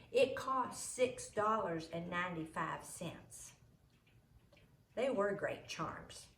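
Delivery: ordinary delivery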